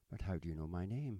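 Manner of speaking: ordinary delivery, nothing marked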